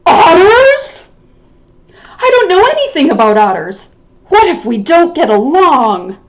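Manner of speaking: nervous little rabbit fretting; worried rabbit. Acted. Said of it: "he sounds worried that they won't get along".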